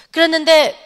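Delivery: usual speaking voice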